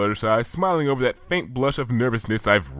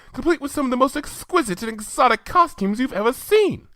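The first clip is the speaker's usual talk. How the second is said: high, genteel lady pony voice; Rarity voice; 11 semitones higher than usual. Acted. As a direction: lift it high and elegant.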